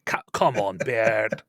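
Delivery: a hint of a Dwarven Scottish brogue, scolding a son; disappointed father in a Scottish accent, pleading and chiding; disappointed Scottish dad. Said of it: "like a disappointed father to his son".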